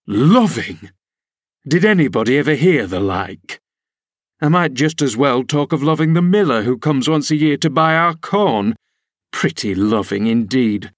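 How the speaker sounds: derisive scolding; mock her notion of love; scornful. A narrator's reading. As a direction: nurse scornfully mocking a girl's romance. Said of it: "as scornfully as I could".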